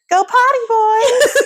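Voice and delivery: high, drawn-out singsong call; child calling dogs. Retold or imitated. Jonah to the dogs at the open door, high calling. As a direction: send the dogs out in a high kid voice. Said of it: "And soon I hear".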